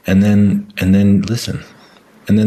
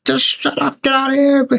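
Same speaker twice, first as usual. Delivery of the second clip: imitating a yell. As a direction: shriek it like a fed-up parent shooing the kid. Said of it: "yelled at the kid".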